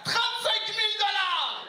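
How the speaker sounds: very high, slow, loud host yell; over-the-top game show host shouting the winnings. Acted. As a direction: scream the sum like a TV host.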